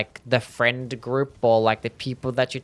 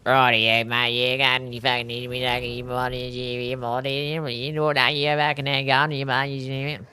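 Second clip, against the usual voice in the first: hammed-up outback Australian, words run together; silly, slurred rural Australian accent; 2 semitones lower, 3 dB quieter than usual. Shown as a demonstration. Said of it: "real rural Australian sounds so silly".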